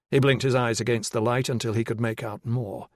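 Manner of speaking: normal talking voice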